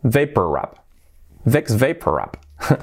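Vex Vapor Rub as "VIKS VAY-per rub"; slow English model of Vex Vapor Rub; English pronunciation. Shown as a demonstration. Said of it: "¿Y cómo se pronuncia esto?".

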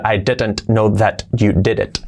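slow, with full t's and d's; over-correct articulation. Shown as a demonstration. Teacher pronounces T's and D's fully, over-correct articulation. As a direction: sound out each word separately. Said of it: "Pronunciando las T's y las D's correctamente".

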